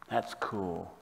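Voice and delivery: soft and low, quietly amazed; hushed awe. Acted. Psalmist marvelling to Heavenly Father, hushed awe. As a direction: let the praise fall to a reverent hush.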